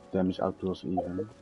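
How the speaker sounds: ordinary talk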